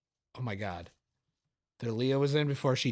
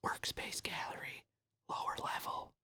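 hushed whisper reading; 18 dB quieter than usual. Real, not acted. Reading signs off the screen, whispered. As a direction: breathe the words out.

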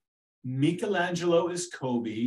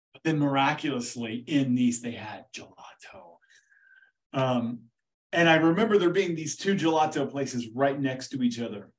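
usual speaking voice

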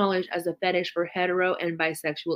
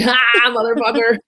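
louder gloating "ha" at the end of the prank; gloating taunt; 5 semitones higher, 9 dB louder than usual. Retold or imitated. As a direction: gloat right in their face. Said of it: "and I'm like".

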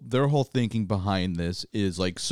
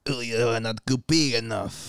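mock French syrup critic's accent; bad French accent. Co-host plays French quality control, bad French accent. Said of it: "in a really bad French accent".

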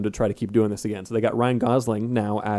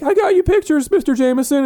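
high, eager voice; a nervous kid pleading with the boss; eager young photographer; 5 dB louder than usual. Retold or imitated. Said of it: "And he's like".

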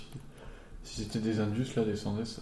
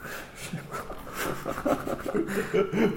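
high-pitched laugh; 7 semitones higher, 4 dB quieter than usual. Real, not acted. Laughing at the smoking image.

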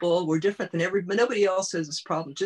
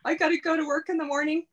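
higher, louder, fast tired husband complaining; husband protesting nightly wakeups, higher and louder; exasperated husband; 10 semitones higher, 4 dB louder, faster than usual. Retold or imitated. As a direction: complain like a worn-out husband needing sleep. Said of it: "he goes".

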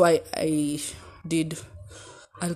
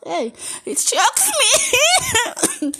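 much higher, slow squeaky voice; high strained voice; 7 dB louder than usual. Shown as a demonstration. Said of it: "Sauti inaninyonga".

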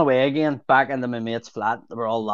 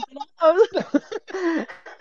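high giggle. Retold or imitated. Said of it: "collapsed laughing".

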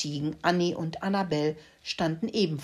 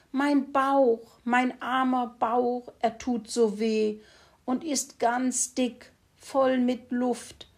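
higher, slow pig wailing over a sore belly; whining sick pig; 6 semitones higher, slower than usual. Acted. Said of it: "jammerte er fürchterlich".

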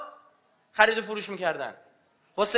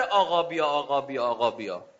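a mocking beggar's plea, sinking lower and softer with each repeat; mocking imitation; 10 semitones lower, 3 dB quieter than usual. Preacher mimics hypocrites calling for the Imam, mocking and fading. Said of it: "ادا در میاره".

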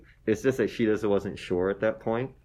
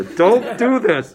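much louder, higher, very slow warning through a laugh; 10 semitones higher, 12 dB louder, much slower than usual. Real, not acted. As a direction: warn the audience, laughing. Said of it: "This is the worst proposal ever".

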